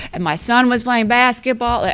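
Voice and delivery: normal talking voice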